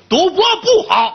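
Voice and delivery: very high, loud bellow of the line; a hammed-up roar through laughter; booming mock shout. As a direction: burst in yelling it out. Li Kui bursts in, booming.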